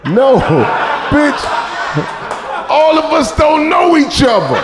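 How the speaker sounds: high, loud mock indignation; indignant retort. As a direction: snap back, offended. Retort to stupid question.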